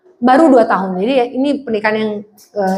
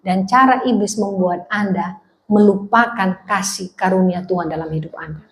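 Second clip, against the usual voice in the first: hushed sermon warning; 4 semitones lower, 3 dB quieter than usual. Acted. Preacher drops voice for warning.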